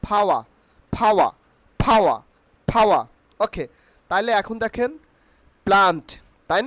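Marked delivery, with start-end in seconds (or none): 0.1-3.0 "ফাওয়া" as phaa-wa, silent r; corrected pronunciation. Shown as a demonstration. Teacher drilling learners, short "ফাওয়া" with no r. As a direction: puff the p in "ফাওয়া", drop the r. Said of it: "আমার সঙ্গে repeat please".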